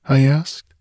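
usual speaking voice

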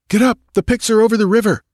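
high, fast shouted warning through the door; urgent shout. A narrator's reading. Balthus shouting at the cabin door, urgent and fast. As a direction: bang out the alarm, fast and high.

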